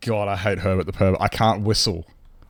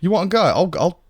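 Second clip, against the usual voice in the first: high, loud mock British tough guy; mock British challenge; 8 semitones higher, 4 dB louder than usual. Daring chat to fight in a mock British accent. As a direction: square up like a lairy Brit, hammed up. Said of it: "that's enough British now".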